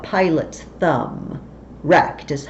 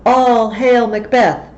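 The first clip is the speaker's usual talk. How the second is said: loud, higher proclamation, slow on each title; booming witches; 4 semitones higher, 7 dB louder, slower than usual. Acted. The witches greet Macbeth with a loud proclamation. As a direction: hail him like a prophecy.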